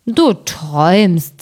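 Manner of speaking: slow, sleepy giant mumble; half-asleep giant brushing it off; lightly voiced drowsy giant. A narrator's reading. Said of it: "sagte der andere".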